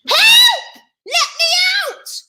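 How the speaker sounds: very high, loud cry for help; bellowed. A narrator's reading. Cinderella trapped with rats in the cellar, bellowing. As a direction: bellow it in a high-pitched panic.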